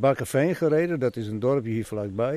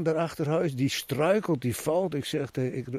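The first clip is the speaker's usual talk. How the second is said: ordinary delivery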